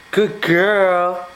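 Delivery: ordinary talk, nothing marked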